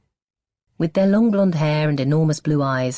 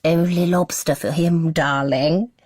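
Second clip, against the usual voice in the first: mock posh accent; 4 semitones higher, slower than usual. A narrator's reading. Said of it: "attempting her own posh accent".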